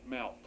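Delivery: MALK said as a single syllable; one-syllable Malk, no helping vowel; all one syllable. Shown as a demonstration. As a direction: run the L straight into the K in Malk. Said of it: "All one syllable".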